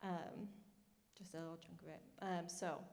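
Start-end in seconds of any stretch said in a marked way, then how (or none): none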